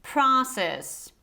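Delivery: process in an American accent, open "PRAH-sess"; American pronunciation. Shown as a demonstration. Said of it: "American pronunciation would be process".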